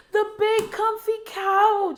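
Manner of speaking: high, loud, slow joyful cry of recognition; excited squeal. Real, not acted. Finding the childhood show again, high excited squeal. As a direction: squeal the name like a long-lost friend.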